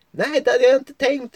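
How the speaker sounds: put-on very high, cheeky girl's voice; dry little joke from the treetop. Girl in the tree replies.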